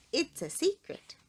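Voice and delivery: ordinary delivery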